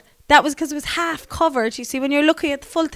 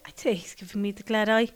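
whisper; 7 dB quieter than usual. Retold or imitated. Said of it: "I was like".

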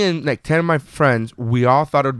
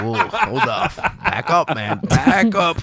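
higher laughing back up; mock self-stop; 4 semitones higher, much slower than usual. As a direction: hold yourself back jokingly. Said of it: "I was like".

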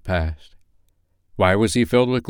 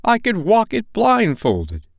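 much higher, slow eager boast; fervent old man; 14 semitones higher, 4 dB louder than usual. Acted. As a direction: insist with glowing fervor. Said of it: "cried Scrooge with fervor".

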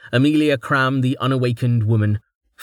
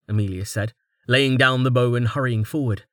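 usual speaking voice

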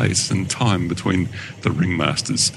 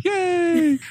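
very high, squeaky little hooray; hammed-up high-pitched celebration; mock cheer. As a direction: cheer like a delighted kid, playfully. Mock cheer in alternating list.

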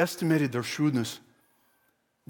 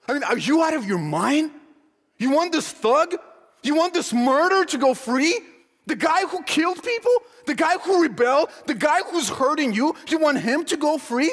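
high, outraged rising questions; incredulous; 7 semitones higher than usual. Acted. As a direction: challenge the crowd in utter disbelief. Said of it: "He can't believe it".

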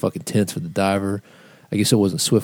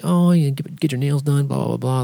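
put-on higher-pitched girly chit-chat, sliding back down; mock being best friends; imitating chummy small talk; 6 semitones higher than usual. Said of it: "chatting her up like they're, they're friends".

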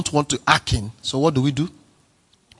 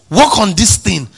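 boss's order; 7 semitones higher, 9 dB louder than usual. Retold or imitated. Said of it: "If your boss at work says".

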